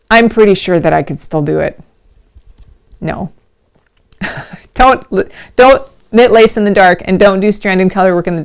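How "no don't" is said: usual speaking voice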